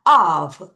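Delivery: "Of" said low and firm as a model; pronunciation demo. Shown as a demonstration. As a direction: model "Of" clearly for repeating. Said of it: "다시 어떻게 해요". ("Of" 발음 시범)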